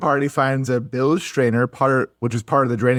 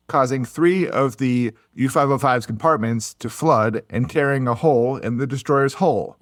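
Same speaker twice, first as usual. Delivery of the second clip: normal talking voice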